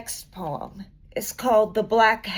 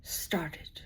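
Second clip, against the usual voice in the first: whispered, much softer close; hushed ending; 5 semitones lower, 14 dB quieter, much slower than usual. Acted. Closing the poem for the audience, a soft whisper. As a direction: let the last words fall to a whisper. Poem's hushed final image.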